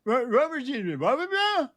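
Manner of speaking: high, garbled gibberish; seal-like barking. Retold or imitated. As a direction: mimic garbled speech as a seal's bark. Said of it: "hears me barking like a seal".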